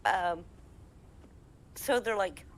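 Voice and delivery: plain reading voice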